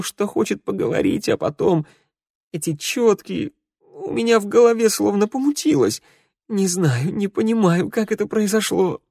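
high voice breaking on the verge of sobs; tearful confession. Acted. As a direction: pour out the guilt, crying, voice climbing. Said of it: "Голос его сорвался на крик, и он захлебнулся плачем".

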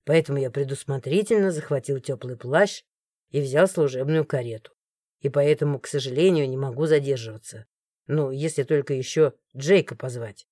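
low, soft, pointed male voice; meaningful guard. A narrator's reading. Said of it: "Многозначительно произнёс он". (многозначительно, низким голосом)

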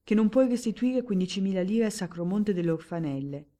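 più forte, diretto, senza giri di parole; amico che incalza. Acted. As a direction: sbattigli in faccia il pettegolezzo. Amico rivela la voce che corre. (amico che svela il debito)

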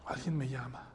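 soft, low aside; hushed noticing. Retold or imitated. Jesus stopping as he hears a cry, hushed noticing. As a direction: say it quietly, as if pausing to listen.

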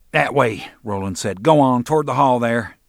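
bully ordering his captive out; barked order. Acted. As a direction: push him down the hall with your voice. Roland ordering McGuire out.

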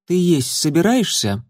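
a strict question from a she-cat; stern she-cat. Acted. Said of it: "строго спросила песчаная буря".

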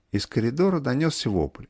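ordinary delivery

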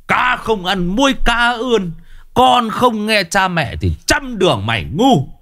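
put-on high-pitched scolding old father, voice cracking with rage; furious father berating his son; hammed-up old man shouting. A narrator's reading. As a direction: rant at the wayward son in a high strained voice. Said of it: "Ông quát lên".

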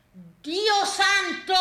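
high, slow cry of dismay; appalled, long-drawn exclamation. Acted. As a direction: stretch it out, climbing high in astonishment.

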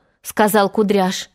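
usual speaking voice

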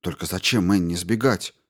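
ordinary delivery

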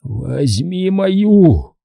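very slow, high, strained offer; dying father. A narrator's reading. Dying father offers his ochre to his son, slow and laboured. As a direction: drag the words out with failing strength.

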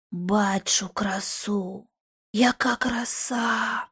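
slow unvoiced awed whisper; entranced whisper. A narrator's reading. George whispers in rapture at the mirror. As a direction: breathe it out spellbound.

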